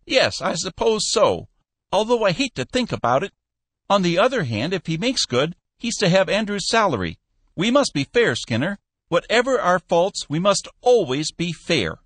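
put-on high old man, earnest and preachy; a fussy old boss insisting on fairness; old Cappy. A narrator's reading. Cappy lecturing Skinner on fairness, high old man voice.

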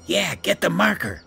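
high, eager sidekick voice; grinning glee. A narrator's reading. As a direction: egg him on with a grin. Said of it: "Phil grinned".

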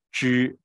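之 said loud and clear with curled tongue; zhi demonstration. Shown as a demonstration. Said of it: "听啊".

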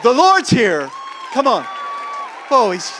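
loud, high, shouted calls; rally the crowd at full force; fiery preaching. Real, not acted. Preacher urging the people, loud shouted preaching.

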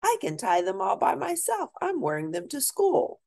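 proud dad showing off new shoes; excited dad. Acted. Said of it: "yelled Dad".

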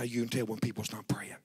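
low breathy whisper; preacher whispering the lesson. Acted. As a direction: lean in and whisper the point. Whispered punchline.

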